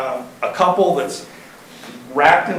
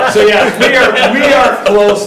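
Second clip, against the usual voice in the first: laughing; 6 semitones higher, 6 dB louder, slower than usual. Real, not acted. Still laughing after the joke.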